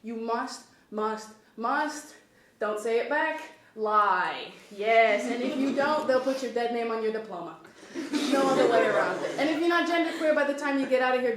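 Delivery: loud, mock-authoritative professor bit; pompous lecturer character. Performer as Dr Dingus Finkelfin lecturing the audience, mock-pompous. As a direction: lecture them as a smug fake doctor.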